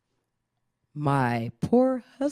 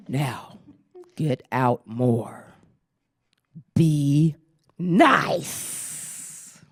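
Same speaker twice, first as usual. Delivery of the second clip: librarian tone; 3 dB louder, much slower than usual. Retold or imitated. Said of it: "librarian ... she had that tone".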